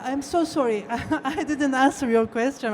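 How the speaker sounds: usual speaking voice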